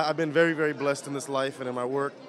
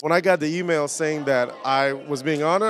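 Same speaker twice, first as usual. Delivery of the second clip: ordinary talk, nothing marked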